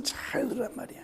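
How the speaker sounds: hushed urgent whisper; whispered plea to heed the word. Real, not acted. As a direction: lean in and whisper the command. Sudden whispered exhortation.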